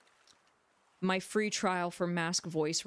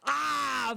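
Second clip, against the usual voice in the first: loud, higher open "ah"; frustrated cry; 6 semitones higher, 3 dB louder than usual. Real, not acted. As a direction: let out a sustained raised groan. Testing pushed voice, throat hurting.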